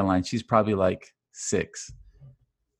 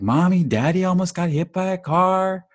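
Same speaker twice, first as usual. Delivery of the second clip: put-on much higher, louder little-girl voice telling on dad; mock child tattling, pitched way up; imitating tattling daughter; 9 semitones higher, 7 dB louder than usual. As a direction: squeal it like a kid running to mom.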